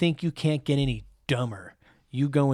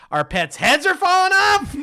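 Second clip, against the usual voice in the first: much higher and louder, a silly character squeal; goofy movie quote; 9 dB louder than usual. Retold or imitated. Quoting his favorite line to the podcast, in a high silly squeal. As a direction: squeal the punchline in a dopey character voice. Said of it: "This is my favorite line".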